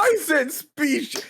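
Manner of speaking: indignant insisting. Real, not acted. Insisting, high pitch.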